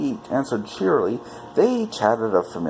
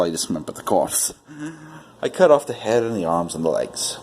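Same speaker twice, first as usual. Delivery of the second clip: plain reading voice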